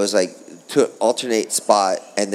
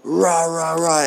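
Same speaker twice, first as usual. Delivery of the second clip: mock yelling; 10 semitones higher, slower than usual.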